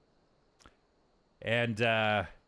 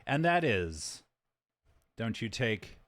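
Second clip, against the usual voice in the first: normal talking voice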